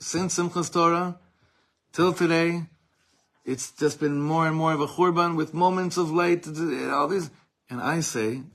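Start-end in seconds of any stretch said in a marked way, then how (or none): 0.0-7.3 loud recital. Acted. Translating the mockers' words.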